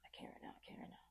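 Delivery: hushed, breathy whisper to herself; whispered inner voice. Retold or imitated. Whispering to herself that she can't face it now. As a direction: barely voice it, holding it in. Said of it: "I'm just like".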